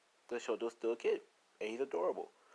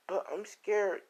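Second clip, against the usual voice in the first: put-on high little voice of a frightened child; scared child; 10 semitones higher, slower than usual. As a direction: imitate a scared kid, pitched up. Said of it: "it's trembling".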